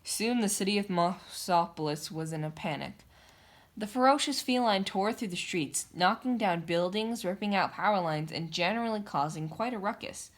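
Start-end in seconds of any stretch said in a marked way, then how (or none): none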